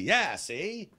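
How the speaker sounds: put-on high old-movie tough guy; a light 1940s guy. Shown as a demonstration. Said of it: "it's in every nineteen forties and fifties video".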